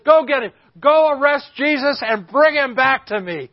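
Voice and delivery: loud, high-pitched furious order; acted angry yell, sustained to the end; enraged chief priest. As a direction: shout commands at the temple guards. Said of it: "that's kind of the emotion".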